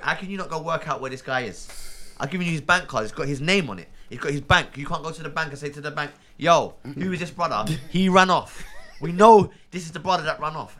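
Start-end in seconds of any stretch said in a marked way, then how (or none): 6.4-10.8 emphatic mock demand. Imagined asking the bank.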